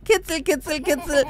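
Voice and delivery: high, playful singsong chant; teasing high-pitched tickle voice; tickling baby talk. Pretend. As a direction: coo it while tickling a baby.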